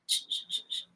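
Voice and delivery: soft unvoiced shh to lull him; soothing shushing. A narrator's reading. Girl shushing the puppy in her arms to sleep. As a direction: hush a baby to sleep. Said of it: "making shushing, soothing sounds".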